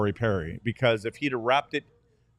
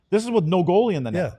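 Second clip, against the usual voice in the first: raised, exasperated and louder; loud sharp voice; 6 semitones higher, 5 dB louder than usual. Real, not acted. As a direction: vent it loudly at him. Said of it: "why are you yelling at me".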